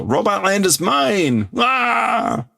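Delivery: high, yelled claim ending in a shriek; hammed-up villain yell. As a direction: screech ownership of Robot Land. Translating villain's shout.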